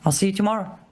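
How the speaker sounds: ordinary talk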